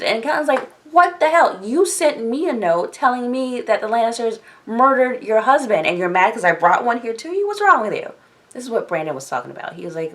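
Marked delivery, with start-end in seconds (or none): 0.9-8.1 loud, high-pitched mock outrage; indignant retort. Catelyn throwing the blame back at her sister, acted indignant. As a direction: argue back, voice jumping up in exasperation.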